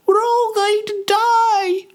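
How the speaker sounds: very high, drawn-out woman's scream; panicked scream. Acted. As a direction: wail it slowly in terror at the top of your range. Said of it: "screamed a woman".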